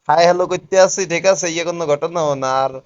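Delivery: usual speaking voice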